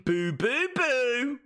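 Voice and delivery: high, rising cooed boos; sing-song baby-talk lilt climbing upward. Acted. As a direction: coo it as if to a baby. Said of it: "sound like I'm talking to a baby".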